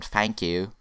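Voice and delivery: mimicked accent, "fank you"; lightly put-on accent turning th into F. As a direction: imitate th-fronting accent on "thank you". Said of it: "someone with that accent".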